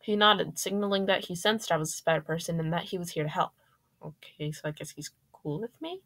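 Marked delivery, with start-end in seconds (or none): 4.0-6.0 soft, tentative musing with rising end; unsure inner thought. A narrator's reading. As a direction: wonder quietly to yourself, ending on a question. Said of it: "I thought as I watched him".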